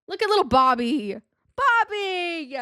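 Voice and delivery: high, loud affectionate call of the name; cooing call. Real, not acted. Vlogger coos at her little Bobby, high and loud. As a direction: call the little toy fondly.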